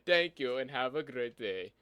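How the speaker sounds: Indian-accented call center agent. Shown as a demonstration. Host posing as Microsoft support to a caller, Indian accent. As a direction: recite the support script in a put-on Indian accent. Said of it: "I could do Indian pretty well".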